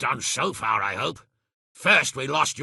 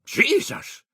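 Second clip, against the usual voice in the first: startled; 14 semitones higher than usual. Acted. Startled lord exclaims.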